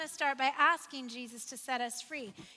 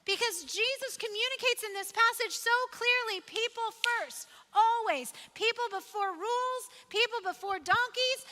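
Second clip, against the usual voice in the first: raised, insistent preaching voice; building preaching; 5 semitones higher than usual. Acted. Pastor urging the congregation, people first, preaching with force. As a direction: drive the refrain home.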